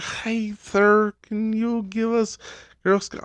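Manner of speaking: put-on high, sweet kid asking; little girl begging a stranger. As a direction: pipe up like a small girl.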